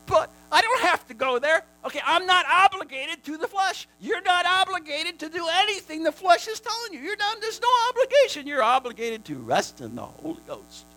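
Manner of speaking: high, loud preaching cry; fiery exhortation. Acted. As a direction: declare freedom from the flesh at full force.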